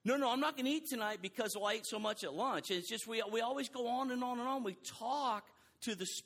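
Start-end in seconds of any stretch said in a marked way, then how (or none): 0.1-2.7 laughing excuse. Pretend.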